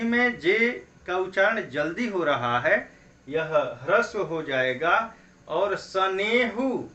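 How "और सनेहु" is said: सनेहु drawn out slowly, ने held long; slow demo word. Shown as a demonstration. Teacher showing students the long ने, slow sounding. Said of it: "सनेहु में ने पर".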